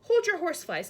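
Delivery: high loud giant call; cried out. A narrator's reading. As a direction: stop them with a loud cry. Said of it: "cried the B F G".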